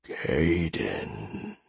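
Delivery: low, soft, eerie monster voice; menacing, otherworldly call of a name; unnatural voice. A narrator's reading. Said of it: "in an unnatural voice".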